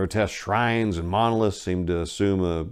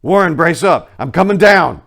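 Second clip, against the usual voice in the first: higher, much louder resolute shout; 9 semitones higher, 13 dB louder than usual. A narrator's reading. Carter shouting down to Warren, resolute shout. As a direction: shout courage down the shaft. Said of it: "I formed and shouted a resolution".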